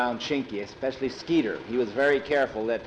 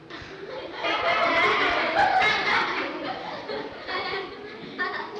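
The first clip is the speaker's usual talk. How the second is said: laugh. Real, not acted. Teller laughs at own joke.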